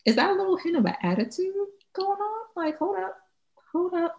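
high, soft, quick double take; suspicious. Acted. Reading a text that might have attitude, high suspicious aside.

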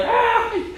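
sudden high-pitched mock shriek; short comic scream at a stranger in the mirror. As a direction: let out a pretend startled scream. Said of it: "You'd scream, you'd go like".

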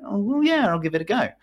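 usual speaking voice

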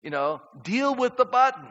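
a gently exasperated God; God's patient plea. Retold or imitated. God asks the fed-up believer to deal with the button, pleading. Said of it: "And God goes".

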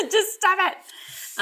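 high, drawn-out plea through real laughter; laughing protest. Real, not acted. Co-host teased by interruptions mid-story, laughing protest. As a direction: beg them to stop while cracking up.

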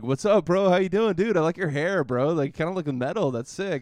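chummy, over-friendly greeting to a stranger; buttering him up. Retold or imitated. Chatting up a stranger for his garlic bread, buttering him up. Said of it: "just kinda warming him up a little bit".

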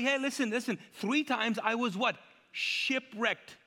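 high, slow, indignant recounting; Paul's rant. Acted. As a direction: stretch out each hardship as a grievance. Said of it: "Paul on a rant".

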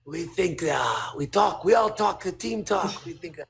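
put-on deliberate, halting Japanese accent with pauses. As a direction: imitate Japanese colleagues reporting their careful guess. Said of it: "here's my Japanese accent".

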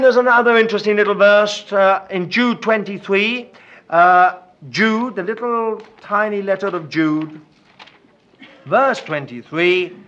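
ordinary talk, nothing marked

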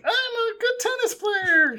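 very high, slow princess voice; sweet fairy tale princess. Retold or imitated. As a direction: chirp it like a cartoon princess. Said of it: "she probably says something like".

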